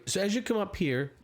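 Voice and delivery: ordinary talk, nothing marked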